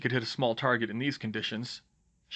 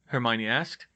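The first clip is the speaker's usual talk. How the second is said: plain reading voice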